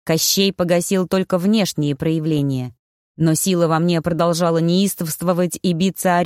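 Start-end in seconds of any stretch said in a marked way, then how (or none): none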